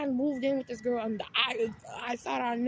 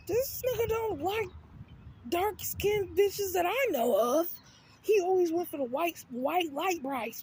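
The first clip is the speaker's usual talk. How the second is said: higher, slow disbelieving aside; incredulous gossip; 4 semitones higher, slower than usual. Retold or imitated. Telling herself about the sneaky link's taste, slow and incredulous. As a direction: spell out the obvious with disbelief. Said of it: "I'm just like".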